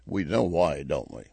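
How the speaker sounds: hushed knowing tone; knowing aside. Real, not acted. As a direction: drop low and soft, conspiratorial.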